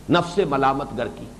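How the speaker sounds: ordinary delivery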